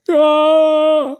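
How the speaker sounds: long, high, loud "aah"; imitated scream. Oracle Smith as light bursts out, long high scream. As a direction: hold one high screamed note. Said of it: "Oracle Smith is like".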